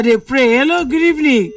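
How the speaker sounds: ordinary talk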